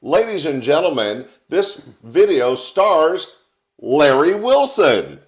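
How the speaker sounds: grand stage introduction, drawn out; announcer voice. Acted. Host announces video.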